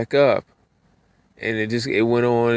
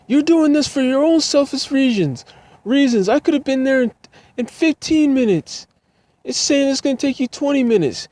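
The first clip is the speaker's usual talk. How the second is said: much higher, louder mock rant; scolding old lady; 3 dB louder than usual. Passenger accusing the driver of a longer route, high loud rant. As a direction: rant at him in a high shrill voice.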